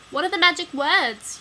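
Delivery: high, loud desperate plea; begging. Acted. Kid begs Wally loudly over the crowd's noise. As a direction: beg over the screaming crowd. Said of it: "you beg".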